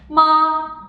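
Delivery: long level "ma"; steady "ma" with no glide; first tone. Shown as a demonstration. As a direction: hold "ma" high on one straight pitch. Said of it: "three tones sound in a row".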